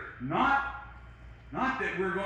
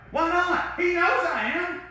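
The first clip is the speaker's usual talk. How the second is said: 1 defiant retort; 12 semitones higher, 4 dB louder, slower than usual. Shown as a demonstration. Preacher answers imagined objection.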